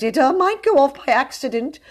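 high, faltering warning; frightened, loud, high-pitched protest; pleading. A narrator's reading. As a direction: plead anxiously with the captor. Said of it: "he pleaded as he went".

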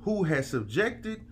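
slow, high, preacher cadence; preaching scripture. Acted. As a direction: read it like a sermon from the pulpit. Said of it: "This man said".

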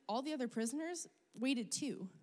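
usual speaking voice

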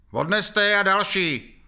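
loud, high, slow command; loud order. A narrator's reading. Sergeant Krpata orders the bodies removed, loud. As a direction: call out the order over the crowd. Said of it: "řekl Krpata".

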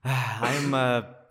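ordinary talk

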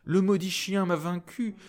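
high-pitched, plaintive complaint; old beggar nun. Acted. Beggar nun complains of the attacking dog, plaintively. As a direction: lament in a high old-woman voice. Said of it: "répondit la nonne".

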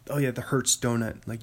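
ordinary talk, nothing marked

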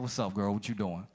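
dropped way low, playing it smooth; deep voice. To his girlfriend on the phone, a put-on deep voice. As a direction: sink the voice deep to charm a girl. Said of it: "the voice gotta go deep".

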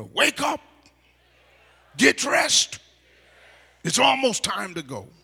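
high, loud rousing shout; wake-up shout. Shown as a demonstration. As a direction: shout to wake a sleeping neighbor. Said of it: "Tell your neighbor".

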